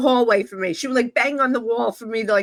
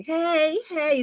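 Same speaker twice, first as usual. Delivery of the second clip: high sing-out "hey"; raised-pitch morning greeting; neighborly greeting; 7 semitones higher than usual. Retold or imitated. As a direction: imitate two neighbors calling hello. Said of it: "that's how we spoke to".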